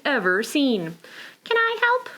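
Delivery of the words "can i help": high little penguin voice. Acted. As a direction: compliment as the penguin. Penguin asks Bootsy.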